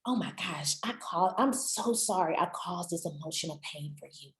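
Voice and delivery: soft, low, contrite voice; hushed, heartfelt acted regret; genuine apology. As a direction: apologise quietly and sincerely. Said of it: "is a genuine, "I'm sorry".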